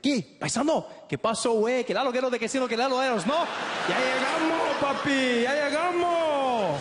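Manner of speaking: high, loud Mexican Spanish banter. Retold or imitated. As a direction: greet him like a long-lost paisano. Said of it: "He's like".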